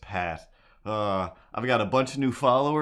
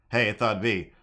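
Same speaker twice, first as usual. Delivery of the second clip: ordinary delivery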